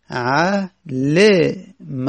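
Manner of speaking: علم sounded out slowly as a-LE-ma; علم syllable by syllable, middle vowel a clear "e"; kasra on middle letter. Shown as a demonstration. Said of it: "یعنی لام کسره داره".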